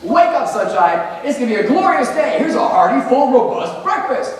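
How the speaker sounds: mock cheery wake-up. Teacher imitating a chipper parent waking a sleepy kid, sing-song. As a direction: chirp it like a relentlessly cheerful morning person. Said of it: "if I walked in and I said this".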